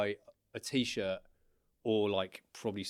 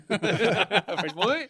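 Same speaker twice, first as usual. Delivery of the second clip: put-on high, loud, laughing French boy; laughing repeat; 9 semitones higher, 6 dB louder than usual. Godfather's friend greeting him, laughing hard. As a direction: crack up while saying the nickname. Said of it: "Her dad will be like".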